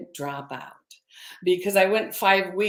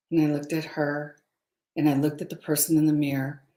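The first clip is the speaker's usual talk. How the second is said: ordinary delivery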